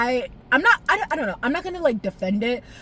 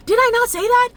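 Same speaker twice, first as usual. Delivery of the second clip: exasperated high shout; 12 semitones higher, 5 dB louder than usual. Real, not acted. Vlogger to viewers, indignant high shout. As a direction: voice jumps high and loud, indignant. Ranting about predicting emotional episode.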